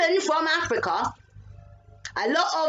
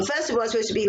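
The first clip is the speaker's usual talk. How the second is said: ordinary delivery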